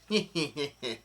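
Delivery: soft tittering cackle; imitated villain giggle. Retelling Brulé passing on Mama's threat, quiet mock villain laugh. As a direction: mimic a quick villain snicker, softly.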